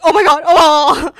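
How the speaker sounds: loud, high, slow frightened cry; panicked shriek. Retold or imitated. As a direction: mimic the scream of panic. Retold fleeing from attacker.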